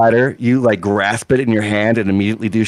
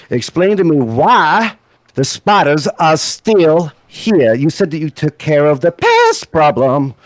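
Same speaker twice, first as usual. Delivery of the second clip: higher-pitched, emphatic demand for an explanation; angry scolding; 5 semitones higher, 4 dB louder than usual. Acted. Purple-haired man demands answers about the pest problem, scolding and indignant. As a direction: scold them, leaning hard on each word. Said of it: "she goes back and scolds you".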